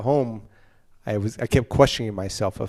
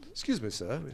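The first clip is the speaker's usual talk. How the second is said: softer, lightly voiced courteous policeman; polite police officer; 7 dB quieter than usual. Polite London policeman to a pulled-over driver, mildly courteous. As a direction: imitate a courteous officer's gentle request. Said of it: "they're very polite".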